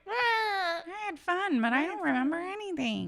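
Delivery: very high, hammed-up whimper; mock whiny apology. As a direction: whine it in a squeaky imitation. Continuing [name] imitation, high whine.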